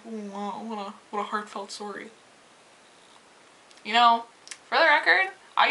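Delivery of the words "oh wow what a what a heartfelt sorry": quiet, dry sarcasm; sarcastic. Retold or imitated. Cashier answering the employee's lame apology, dry sarcasm. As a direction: sneer at the half-hearted apology.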